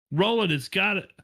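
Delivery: high, alarmed call for help; urgent shout. A narrator's reading. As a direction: shout a warning, pitch jumping up. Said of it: "Eddie shouted".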